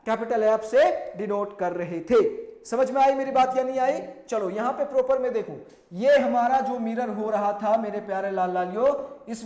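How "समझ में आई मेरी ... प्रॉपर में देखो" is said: ordinary delivery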